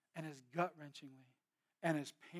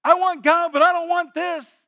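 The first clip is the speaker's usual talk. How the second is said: anguished plea; 12 semitones higher, 21 dB louder, slower than usual. Retold or imitated. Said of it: "And she goes".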